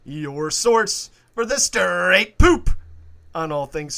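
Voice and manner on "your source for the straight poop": spooky announcer voice. Acted. Hammy podcast show intro.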